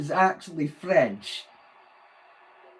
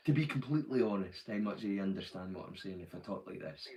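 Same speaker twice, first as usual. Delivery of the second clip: relaxed, low and soft Doric Scots; natural Doric accent; 4 semitones lower, 5 dB quieter than usual. Shown as a demonstration. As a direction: speak everyday broad Doric, unguarded. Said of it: "natural accent".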